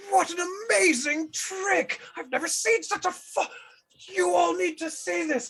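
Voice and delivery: excited shout. Acted. [name] calls his gang over, ecstatic and high. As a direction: call the whole crew over in rapture.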